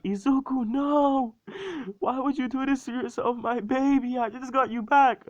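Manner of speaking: very high, loud mock wail; hammed-up hysterical crying in falsetto; crying mother. Mother crying over her stabbed son, hammed-up wail. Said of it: "she's, like, crying over his".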